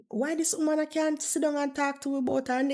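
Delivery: ordinary delivery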